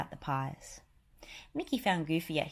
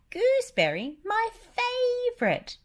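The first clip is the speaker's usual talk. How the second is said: Gladstone Gander cry; 7 dB louder than usual. Acted. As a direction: cry out in delight. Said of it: "cried Gladstone Gander".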